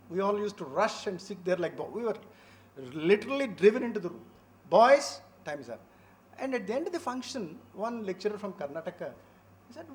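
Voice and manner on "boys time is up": a louder, clipped repeat of her summons; retelling the librarian's call to the boys, firmly raised; mock stern call. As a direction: bark the boys back into the room. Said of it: "And she used to say".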